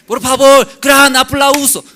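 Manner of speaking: high, loud hammed-up MC hyping the crowd; overbearing master of ceremonies, shouted high; pushy presenter. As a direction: play the host forcing applause. Presenter demands applause.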